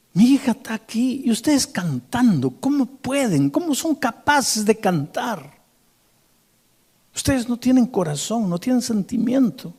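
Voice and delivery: high, anguished father's protest; a broken father accusing the singers; grieving father. Retold or imitated. Scene: a grieving father at his daughter's grave reproaches the choir, anguished.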